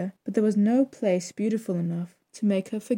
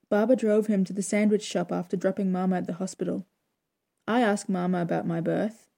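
usual speaking voice

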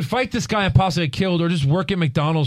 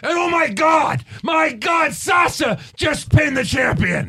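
mocking Cole's call; 13 semitones higher, slower than usual. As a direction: scream it like a hyped announcer who thinks it matters. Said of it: "Cole has to say his line".

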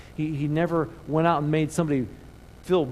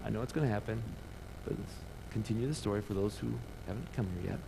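quiet Jesus; 5 semitones lower, 12 dB quieter, faster than usual. Acted. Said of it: "Jesus is like".

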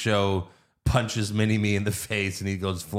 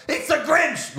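much higher squeaky cry; elf yell. Retold or imitated. Podcaster voices the elf yelling at the police, high squeak. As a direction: yelp it like a panicked elf. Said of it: "the elf yells".

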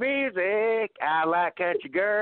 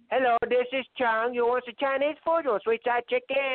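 hammed-up Chinese restaurant accent, a bit higher; mock Chinese accent; 4 semitones higher than usual. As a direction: put on a cartoonish Chinese accent. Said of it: "get us canceled with that accent there".

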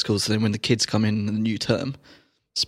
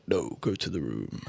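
stern officer; 7 semitones lower, 8 dB quieter than usual. Retold or imitated. Stern customs officer retold.

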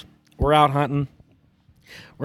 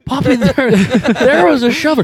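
higher, giggling through it; boy sheepishly explaining the shot, laughing mock voice; laughing kid excuse; 8 semitones higher, 5 dB louder than usual.

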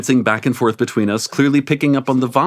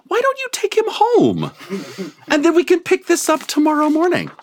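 much higher, knowing wingman hint; sly wingman voice. Acted. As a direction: nudge them off with a wink. Porter's hint voiced in story.

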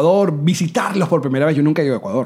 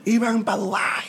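mock fan complaint; 5 semitones higher, 7 dB quieter, slower than usual. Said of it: "hay una gente que nos está gritando".

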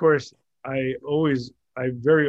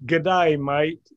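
Australian accent; 2 semitones higher, 4 dB louder than usual. Shown as a demonstration. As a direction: try on a broad Australian accent. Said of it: "this was Australian".

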